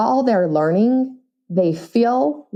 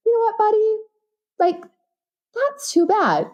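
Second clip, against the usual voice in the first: mock breezy parent; 13 semitones higher than usual. Mock calm parent reply.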